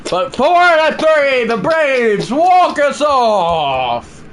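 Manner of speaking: loud, high, slowed announcer shout; walk-off call. Acted. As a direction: sell the walk-off like a broadcaster.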